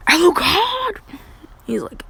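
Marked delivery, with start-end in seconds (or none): 0.1-1.0 high, loud drawn-out yell. Retold or imitated. Woman hollering for Alucard, loud high yell. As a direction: yell the name out high and long. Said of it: "She just yells".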